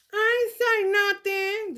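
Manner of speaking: high, slow little voice playing innocent; innocent child denial. Child to Jesus, high, slow, innocent voice. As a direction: squeak it out like a kid caught lying.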